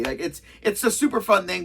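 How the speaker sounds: usual speaking voice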